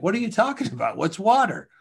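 confused fish. Retold or imitated. Fish voiced in a joke.

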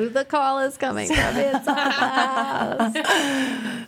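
high, slow, laughing spooky delivery; mock horror movie line. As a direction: play it like a hammed-up thriller tagline.

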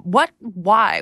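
ordinary delivery

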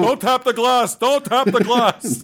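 very high, indignant put-on voice; Bernie Sanders. Senator exhibit scolding zoo visitors, hammed-up indignant impression. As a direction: scold the onlookers, drawn out. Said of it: "Put people like Bernie Sanders in the accent zoo".

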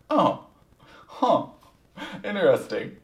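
high, loud "huh" of surprise; taken aback. Retold or imitated. Brother hears sister refuse to share, taken aback. Retold reply to unexpected refusal.

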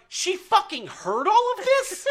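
high-pitched incredulous outburst, re-enacted; squeaky-high mock shock; retold disbelief. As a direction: act out appalled disbelief, voice shooting up. Acted shocked reaction in story.

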